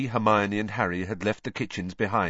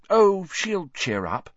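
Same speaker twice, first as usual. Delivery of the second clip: doubtful; 9 semitones higher, 4 dB louder, slower than usual. Acted. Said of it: "she sounded a bit doubtful".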